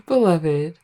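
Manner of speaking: laughing. Real, not acted. Laughs while calling partner.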